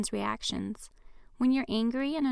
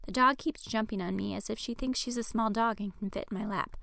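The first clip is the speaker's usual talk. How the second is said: ordinary delivery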